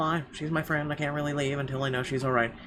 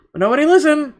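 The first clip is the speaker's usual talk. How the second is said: much louder, higher burst of frustration; frustrated outburst; 14 semitones higher, 13 dB louder, faster than usual. Acted. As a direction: burst out bitterly. Frustrated outburst about coworkers.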